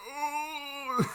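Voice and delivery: sudden high squeal of disgust at soggy food; a sharp, shocked oh; disgusted yelp. Retold or imitated. Touching wet food in the sink, squealing in disgust.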